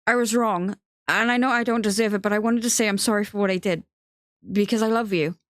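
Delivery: urgent, remorseful declaration of love; pleading confession. Acted. Theo apologising to Benji and confessing love, pleading.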